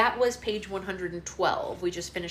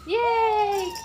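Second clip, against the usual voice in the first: high loud cheer; 7 dB louder than usual. Real, not acted. Mother cheers her baby on, high cheering.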